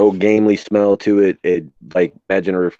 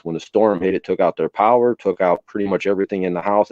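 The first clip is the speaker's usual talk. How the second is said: normal talking voice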